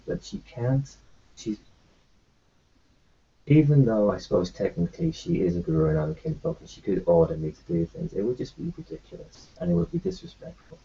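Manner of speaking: usual speaking voice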